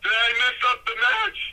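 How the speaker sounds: high, worried yell down the hallway; retold yelling. Dazed wrestler yelling on the way back to the dressing room, retold yelling. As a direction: call it out high, a bit loud, re-enacting a dazed wrestler. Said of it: "I'm yelling on the way back".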